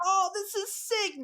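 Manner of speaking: high, slow, awed excitement; retold thrill. Retelling learning the event is in Tomorrowland Theater, thrilled. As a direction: stretch it out in high-pitched delight.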